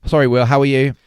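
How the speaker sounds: usual speaking voice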